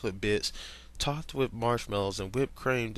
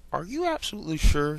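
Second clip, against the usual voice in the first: concerned; 5 semitones higher, 6 dB louder, faster than usual. Acted. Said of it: "concerned for Steven".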